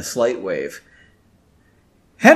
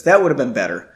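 ordinary delivery, nothing marked

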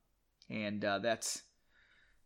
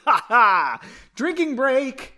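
slow, high, loud call of drinking break; excited announcement; 9 semitones higher, 9 dB louder, slower than usual. Real, not acted. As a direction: announce it like a game show host. Host calls a drinking break.

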